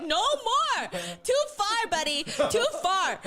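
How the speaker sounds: high, pleading yell of a panicked customer; mock yell. Customer yelling at the barber to stop cutting. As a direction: shout it like the barber went too far.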